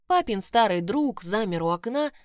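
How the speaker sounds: ordinary delivery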